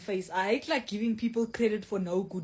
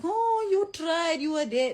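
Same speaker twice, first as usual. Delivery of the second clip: high slow mocking sympathy voice; mock consoling; 9 semitones higher, 7 dB louder than usual. Mocking people who console losers. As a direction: mimic pitying people sweetly. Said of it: "People can always say, like".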